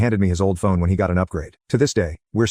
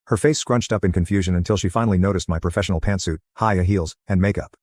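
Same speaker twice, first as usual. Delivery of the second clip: plain reading voice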